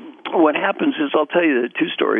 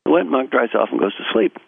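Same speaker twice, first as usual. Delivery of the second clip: normal talking voice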